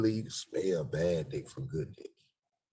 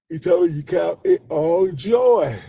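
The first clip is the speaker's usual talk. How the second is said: higher, louder, slow; mock preachy rebuke; 11 semitones higher, 13 dB louder, slower than usual. Preacher telling him not to stay sad, loud slow. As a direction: scold like a church elder.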